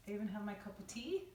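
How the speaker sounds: ordinary delivery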